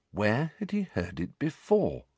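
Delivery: ordinary delivery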